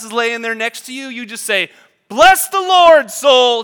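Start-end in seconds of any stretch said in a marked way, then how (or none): 2.1-3.6 loud, high, slow yell of bless. Shown as a demonstration. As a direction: shout it at your sleeping spouse. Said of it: "Just, like, yell it out".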